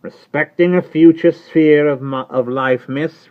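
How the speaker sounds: high, loud rough servant voice; Jerry Cruncher. Acted. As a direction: answer her like a loyal, plain-spoken servant. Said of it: "returned Mister Cruncher".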